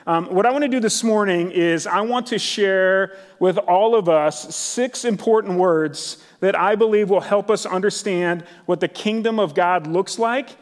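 ordinary delivery

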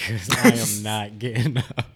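laughing. Retold or imitated. Laughing about being stuck on counter.

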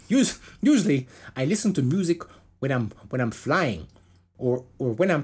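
faltering mock Minnesota accent. Podcaster restarting bassist's quote, failing at a Minnesota accent. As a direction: attempt a Minnesotan, stumbling over it. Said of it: "fuck that Minnesota accent".

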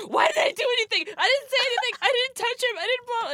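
high, loud frantic mock panic; all caps panic. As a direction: squeal it like a panicked text. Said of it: "all caps".

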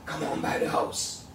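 soft hoarse whisper with a laugh. Retold or imitated. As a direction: rasp the invitation through a chuckle. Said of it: "his raspy voice".

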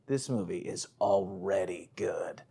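hushed, low whisper; under his breath. Retold or imitated. Moviegoer remarking as the film begins, whispered under his breath. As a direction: whisper it as if to yourself. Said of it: "said under my breath".

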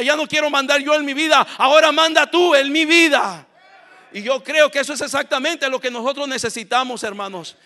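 high, loud, building pulpit voice; full-force preaching. Real, not acted. Preacher urging congregation to submit to God, fervent preaching. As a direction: build fervently toward a shouted surrender.

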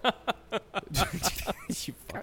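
giggling through the words; breaking character giggling. Real, not acted. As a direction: cracks up mid-sentence, slow and laughing. Laughing through sponsor read.

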